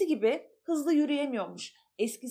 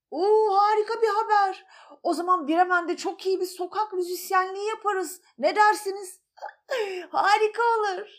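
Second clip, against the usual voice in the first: higher, louder cheerful voices; excited animal voices; 7 semitones higher, 5 dB louder, faster than usual. Acted. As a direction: celebrate the plan. (yüksek tonlu coşkulu sesler)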